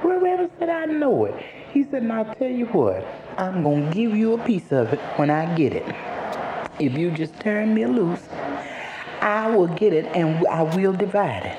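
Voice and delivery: high trickster rabbit voice, easing down as he bargains; wily Brer Rabbit, coaxing. Acted. Brer Rabbit bargaining for release, high and coaxing. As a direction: coax him in a high-pitched rabbit voice.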